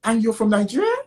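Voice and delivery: mock surprise, pitched high; mock surprised question, pitched high. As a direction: act amazed at where he's from. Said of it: "it's like".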